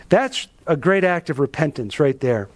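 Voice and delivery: ordinary delivery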